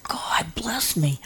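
slow, soft whispered prayer; pained whisper. Retold or imitated. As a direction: murmur it slowly through the pain.

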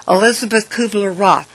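normal talking voice

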